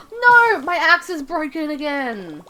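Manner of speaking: high, loud cry sinking into a slow lament; dismayed outcry. Real, not acted. Axe breaks in the game.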